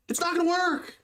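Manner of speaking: exasperated. Real, not acted. Exasperated rant about shield.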